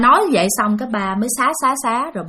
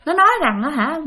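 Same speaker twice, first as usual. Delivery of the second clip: ordinary talk, nothing marked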